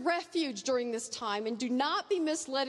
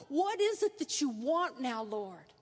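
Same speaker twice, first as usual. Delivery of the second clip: desperate plea; 2 semitones lower than usual. Acted. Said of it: "journey of desperation to know".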